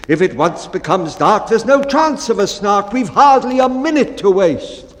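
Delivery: loud, high, hurried captain; urgent bellman. Acted. Bellman warning the baker there's no time, urgent and loud. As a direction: press the crew that time is short.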